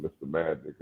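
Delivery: normal talking voice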